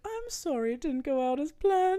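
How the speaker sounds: high sugary apology; mock devil apologizing to husband, high and sweet. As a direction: coo it like a sly temptress. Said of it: "And she's like".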